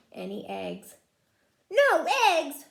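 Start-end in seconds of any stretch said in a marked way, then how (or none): 1.7-2.7 loud, higher exasperated cry; cried out. Acted. Farmer Brown finds no milk, loud upset cry. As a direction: wail it in dismay. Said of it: "cried Farmer Brown".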